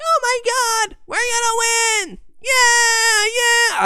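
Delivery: put-on very high, ecstatic kid fan squealing; prepubescent boy squeal. Teenage fan thinks his team will win, high boyish squeal. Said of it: "My balls hadn't dropped yet".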